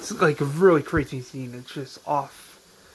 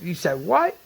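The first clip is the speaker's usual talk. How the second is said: louder, incredulous outburst; mock angry disbelief; freaking out; 3 semitones higher, 4 dB louder than usual. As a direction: retell him blowing up in disbelief. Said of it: "Ed freaks out".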